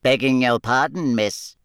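bow and apologise politely; polite elf. A narrator's reading. The house elf politely answers Hermione in an elf voice. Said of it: "said the house elf".

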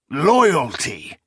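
sharp scornful snap; snapped. A narrator's reading. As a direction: bite off the word in scorn. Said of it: "Notsera snapped".

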